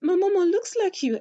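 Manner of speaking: high, loud mock of a tearful plea; imitating a staged, weepy line; mocking her crying. Vlogger mocking the actress's tearful plea, high and wailing. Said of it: "She is crying, saying".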